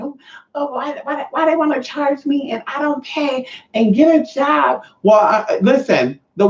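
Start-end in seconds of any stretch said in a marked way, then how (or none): 0.0-4.8 high, slow imitation of a whiner; mock complaining. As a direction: put on a high, petulant grumble. Mimicking complaining men who won't pay.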